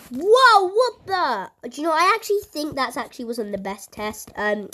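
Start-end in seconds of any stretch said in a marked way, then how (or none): 0.1-1.7 startled whoa. Real, not acted. Car moves again.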